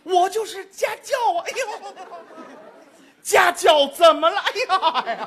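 put-on whining child about to wet himself; panicking child. Child hears the word tutor and panics, whining. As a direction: act the kid squirming in panic. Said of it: "您再看这孩子".

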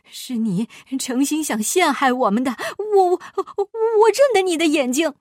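agitated accusation rising to a high, loud cry; stammer, then yell in desperation; worked-up, urgent outburst; frantic shout. A narrator's reading. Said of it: "急得喊了起来".

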